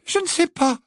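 high, stammering apology; terrified squeaking. Acted. Hermione apologising to the giant, terrified squeak. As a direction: squeak and stumble over the words in fear. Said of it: "couina-t-elle, terrifiée".

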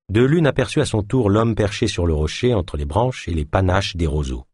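ordinary delivery, nothing marked

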